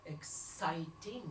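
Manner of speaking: low, slow seductive English; seductive whisper. Acted. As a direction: breathe it into her ear. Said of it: "Il me susurre".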